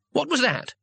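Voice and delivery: very high, startled question; alarmed. A narrator's reading. Two Flower hearing noises outside, alarmed. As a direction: ask it with a jump in pitch.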